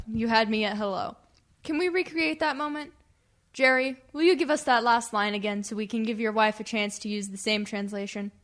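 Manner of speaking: ordinary talk, nothing marked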